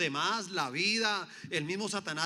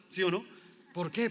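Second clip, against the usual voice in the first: ordinary talk, nothing marked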